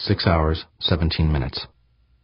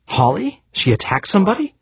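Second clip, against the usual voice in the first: high-pitched, incredulous questions; shocked disbelief. A narrator's reading. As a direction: voice leaping up in astonishment. Shocked reaction to news of attack.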